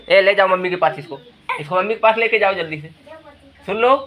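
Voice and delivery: hurried order. Real, not acted. Father telling someone to take the child to mummy quickly, urgent call.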